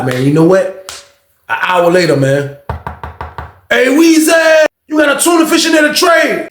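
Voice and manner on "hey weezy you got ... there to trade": high, loud holler; high-pitched retold yell; imitated calling out. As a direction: shout a request through the door. Visitor calls at cell door.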